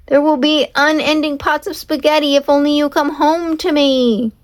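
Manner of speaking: high pleading call; pleading shout. Acted. Leroy bribing his horse with spaghetti, pleading shout. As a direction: promise it out into the storm. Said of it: "Leroy shouted".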